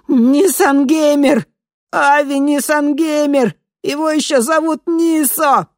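very high panicked squeal; rubbery high squeaky toy. A narrator's reading. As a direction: shriek the name in panic. Victim squeals the name.

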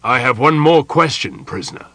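loud, weighty announcement, dropping on the last word; ominous official pronouncement; portentous. A narrator's reading. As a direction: announce it with heavy ceremony. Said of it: "continued in portentous tones".

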